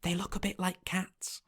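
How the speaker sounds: whispered aside. A narrator's reading. Meritus whispering a private remark to Bo, soft and hushed. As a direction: hush it so the ferryman won't hear. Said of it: "Meritus whispered to Bo".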